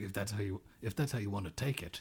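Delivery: hushed, low grumbly man; mock grumbly voice, barely above a murmur; grumbly Bates. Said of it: "we always give him the grumbly voice".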